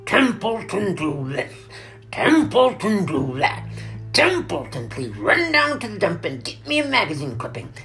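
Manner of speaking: loud, high, grumbling mockery; disgusted. A narrator's reading. Rat resents running errands, disgusted. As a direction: complain loudly, parroting every order. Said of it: "he said in disgust".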